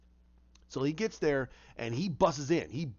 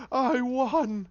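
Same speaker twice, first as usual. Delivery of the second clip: high, crowing villain voice; gloating villain; 8 semitones higher, 4 dB louder than usual. Retold or imitated. Villain gloats over beaten Batman, crowing high. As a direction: crow the win in a high voice.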